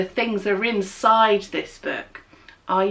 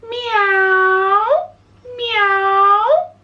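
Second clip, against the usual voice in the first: high, louder meowing; cat meows; 9 semitones higher, 4 dB louder than usual. Shown as a demonstration. Teacher shows the children how a cat meows. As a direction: meow like a cat. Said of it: "a cat goes".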